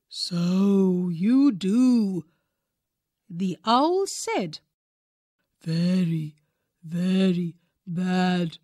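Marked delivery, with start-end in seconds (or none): 0.1-2.2 slow, hushed, breathy owl voice; an old owl agreeing slowly; whispery wise owl. A narrator's reading. Wise owl answering Roger Skunk, slow and hushed. 5.6-8.6 put-on a solemn old bird; low wise owl. A narrator's reading. Owl voice version.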